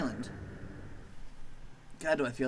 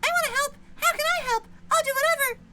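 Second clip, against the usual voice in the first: much higher, louder squeaky threat; squeaky tiny maggot; 5 dB louder than usual. Acted. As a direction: squeak a tough-guy warning.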